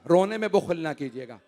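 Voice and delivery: ordinary talk, nothing marked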